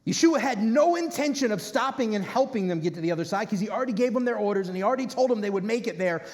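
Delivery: ordinary delivery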